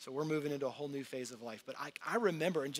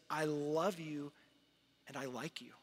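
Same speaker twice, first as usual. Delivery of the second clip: tender voice of God; 3 semitones lower, 8 dB quieter than usual. Acted. Father speaking to his child, soft and tender. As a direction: lean in close and say it softly. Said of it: "He looks at you and he says".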